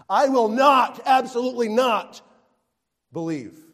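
shouted, high, punching not; preacher voicing Thomas's double negative, shouting; emphatic refusal. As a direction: bark the double no. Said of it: "He is emphatic".

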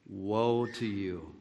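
slow, soft grave pronouncement; slow sorrowful woe, quietly pronounced; solemn lament. Retold or imitated. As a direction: say it low and slow, heavy with pity. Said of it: "And that's how he said it".

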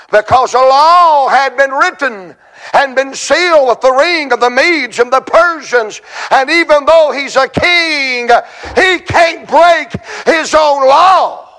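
high, urgent pulpit plea; impassioned preaching. Acted. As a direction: cry it out like a revival preacher. Preaches at full force.

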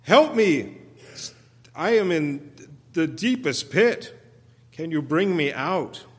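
loud, high cry fading to a plea; desperate psalmist crying out, easing off; screaming for help. Acted. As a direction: shout for rescue from the pit, then soften. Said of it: "just screaming out".

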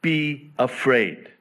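rally the fearful people; Moses commanding. Retold or imitated. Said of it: "He says".

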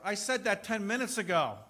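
exasperated wife. Retold or imitated. Wife scolding husband who ignored her, exasperated. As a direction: snap back as the annoyed wife. Wife annoyed, repeating herself.

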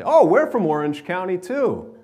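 high, loud imitated New York accent. Older women quizzing fellow New Yorkers, mimicked New York accent. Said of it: "a few older women, they were talking like this".

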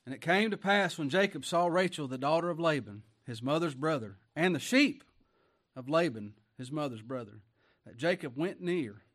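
booming pulpit reading of scripture; loud scripture reading. Acted. Preacher reading Genesis aloud to congregation, loud and proclaiming. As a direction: proclaim the verses to the whole church.

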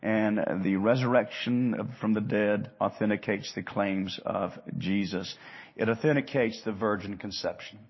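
ordinary talk

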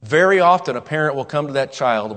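ordinary talk, nothing marked

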